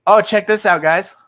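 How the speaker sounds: normal talking voice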